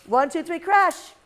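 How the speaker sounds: countdown rising to a loud crash; excited countdown. Real, not acted. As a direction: count them in and shout crash. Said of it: "countdown again for kids".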